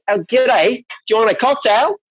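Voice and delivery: high, hammed-up Kiwi bartender greeting; hammed-up g'day.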